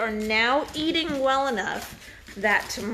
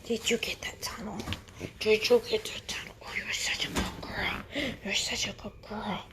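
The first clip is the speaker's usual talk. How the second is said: soft unvoiced whisper, coaxing a pup; whispering to a puppy; 6 dB quieter than usual. Real, not acted. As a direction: breathe the praise gently to a puppy.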